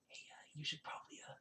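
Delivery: fast hushed whisper. Acted. Shoal warning the girl under his breath, whispered. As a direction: whisper it quick so no one hears. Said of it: "he kinda goes like".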